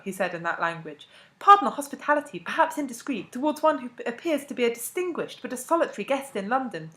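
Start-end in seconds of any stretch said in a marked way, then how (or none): 1.4-6.9 high, loud, ceremonious address; pitched-up flowery courtesy, rising as it goes; elaborately courteous invitation. A narrator's reading. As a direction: deliver a florid, formal invitation to a foreign dignitary. Formal invitation in supposed Spanish.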